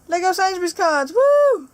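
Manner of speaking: loud, high hype with a whooped ending; hyped-up show opener, rising to a "woo"; excited intro. Acted. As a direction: kick off the video with a big cheer. Delivering the requested video intro.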